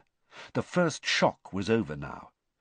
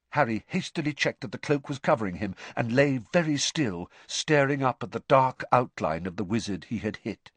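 plain reading voice

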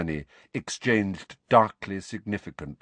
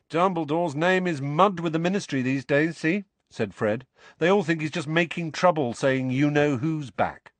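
higher, animated character voice; lightly voiced, urgent brother; emphatic Fred; 7 semitones higher than usual. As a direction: explain the bad news with energy.

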